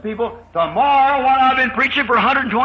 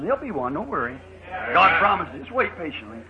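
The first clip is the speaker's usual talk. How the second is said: usual speaking voice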